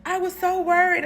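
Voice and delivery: imitating a worked-up sugar daddy, high and loud; high, urgent mock panic; frantic worry. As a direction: fret loudly over your baby. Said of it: "that man went crazy".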